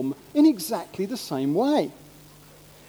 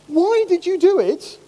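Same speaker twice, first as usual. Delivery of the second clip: higher, pressing radio presenter's question; Victoria Derbyshire; 6 semitones higher, 4 dB louder than usual. Retold or imitated. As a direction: put it to the caller, pitched up. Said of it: "asks Victoria Derbyshire".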